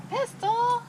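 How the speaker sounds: high sing-song call to the dog. Real, not acted. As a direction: call the dog's name brightly. Calling the dog.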